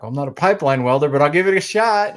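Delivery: quick, rising to high and loud on the last words; hesitant but game, pitch climbing into a half-laugh; mock reluctant reply. Retelling to the host how he answered his dad, rising into a laugh. Dad asks him to weld pipe.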